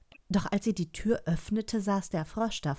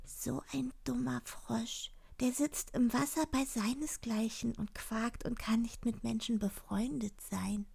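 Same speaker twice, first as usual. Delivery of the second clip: high, whispery princess aside; hushed, high inner voice; thinking to herself; 5 semitones higher, 6 dB quieter than usual. A narrator's reading. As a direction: scoff privately in a soft high voice. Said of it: "Aber sie dachte sich".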